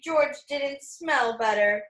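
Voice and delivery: ordinary delivery, nothing marked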